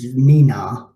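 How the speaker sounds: a child's mock siren "nee-naw" call; sing-song child's siren imitation; child imitating a siren. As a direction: play a small kid copying a police siren. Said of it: "points at a police car and says".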